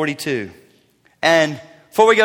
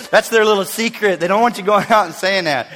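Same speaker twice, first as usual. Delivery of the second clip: usual speaking voice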